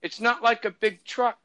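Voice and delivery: high-pitched mock senator voice; put-on high voice for a quoted politician; Ted Stevens. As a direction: imitate a senator explaining, pitched well up. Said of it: "he literally calls it".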